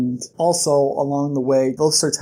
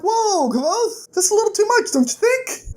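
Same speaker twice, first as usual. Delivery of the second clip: much higher, squeaky mock alarm, half crying; shocked high squeal. Viewer squealing in shock when Kavoth breaks a boy's arm. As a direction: gasp it out as if the scene shocked you. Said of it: "I'm like".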